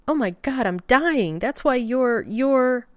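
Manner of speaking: high, loud, rushed panic about dying; panicked. Acted. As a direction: blurt it out in rising alarm.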